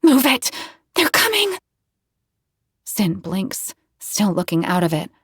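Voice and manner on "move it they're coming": urgent sharp call, rising high; hiss. Acted. Narrator urges dazed Sin to flee, sharp urgent high call. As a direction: hurry him along urgently. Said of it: "turn back and hiss".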